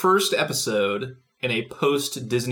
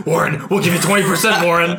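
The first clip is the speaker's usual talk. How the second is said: loud, pleading higher-pitched sales pitch; hammed-up hard sell; masked elf pitch; 4 semitones higher, 5 dB louder than usual. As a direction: pester him with an urgent offer, voice up. Acted pitch as masked elves.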